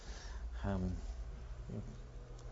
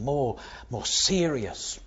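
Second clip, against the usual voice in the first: usual speaking voice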